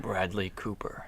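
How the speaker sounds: slow menacing villain whisper; whispering Bond villain. Acted. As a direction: breathe the name like a Bond villain. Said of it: "it sounds like a Bond villain".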